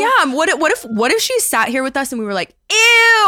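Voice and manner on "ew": very high, loud drawn-out shriek; mock disgust. Imagining hosts reacting rudely to their guest, mock disgusted shriek. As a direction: screech it in hammed-up revulsion.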